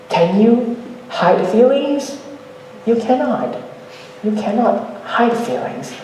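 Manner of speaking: ordinary delivery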